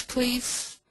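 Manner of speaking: usual speaking voice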